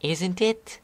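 a touch of nervous doubt. Acted. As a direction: ask it unsure of yourself. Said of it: "replied nervously".

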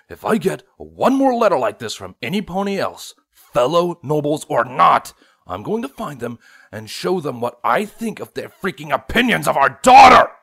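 angry shout building to a yell. Acted. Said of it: "Nightlight shouted".

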